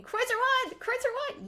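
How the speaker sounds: high, loud shouted call; screaming. Retold or imitated. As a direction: yell it across the room. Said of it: "I was just screaming".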